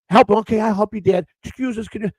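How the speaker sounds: high-pitched retold daughter voice; lightly acted young helper; imitating his daughter. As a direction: pitch it up as the daughter playing along. Daughter playing along in Walmart, retold.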